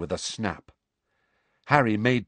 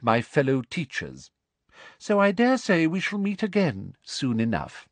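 high-pitched, superior official; cold, high official's voice; officious inquisitor; 7 semitones higher than usual. Acted. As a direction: announce your authority with sweet menace. Voices Umbridge.

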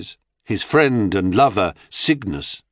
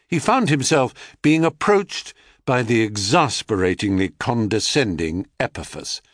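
usual speaking voice

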